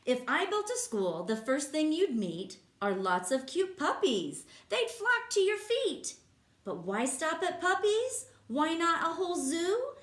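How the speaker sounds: lively, sing-song read-aloud voice; reading to children. Acted. Teacher reading a rhyming book to kids, lively. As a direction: bounce the rhymes for the class.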